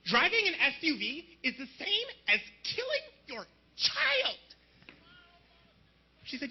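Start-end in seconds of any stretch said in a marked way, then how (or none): 0.0-4.4 high-pitched, drawn-out scolding; preachy activist girl impression; militant environmentalist girl. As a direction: lecture him in a mock girl's voice, climbing high. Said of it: "This one girl actually came up to me".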